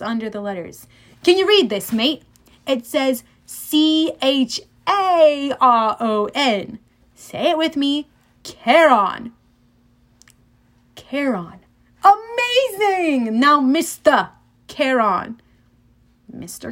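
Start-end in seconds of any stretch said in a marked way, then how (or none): 1.2-9.3 put-on British accent, spelling out letters slowly; strange British-ish accent. A narrator's reading. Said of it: "British maybe". 12.0-15.4 slow, high mock praise in British accent; strange British-ish accent. A narrator's reading. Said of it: "British maybe".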